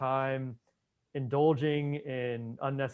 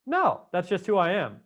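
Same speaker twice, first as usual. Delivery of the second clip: higher, louder dismissive reply; mock stubborn refusal; 6 semitones higher, 6 dB louder than usual. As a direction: imitate someone refusing to budge. Acts out refusal to change.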